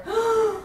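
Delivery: high sharp gasp of horror; horrified gasp. Acted. Dentist seeing himself in underwear, gasping. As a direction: gasp at your own reflection. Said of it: "he stopped in horror".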